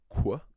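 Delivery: "kwa" as one isolated loud syllable; sound demonstration. Shown as a demonstration. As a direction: sound out "kwa" with a clear "kw" glide. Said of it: "the sounds".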